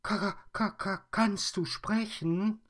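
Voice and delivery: put-on very high, loud stammering girl; frightened stammer. Mia is startled the bear speaks, stammering very high. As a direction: stutter in shock. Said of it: "Mia erschreckt".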